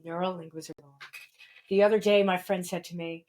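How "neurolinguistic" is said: quiet repeat. Real, not acted. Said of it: "Neurolinguistic programming".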